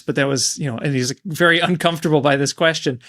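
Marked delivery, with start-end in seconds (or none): none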